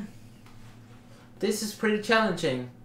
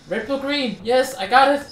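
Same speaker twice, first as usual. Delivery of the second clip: high, louder cry of relief; excited triumph; 8 semitones higher, 7 dB louder than usual. Real, not acted. Player cheering at finally finding the right item, excited triumph. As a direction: celebrate finally getting it.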